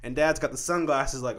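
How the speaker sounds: ordinary delivery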